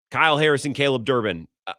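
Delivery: ordinary delivery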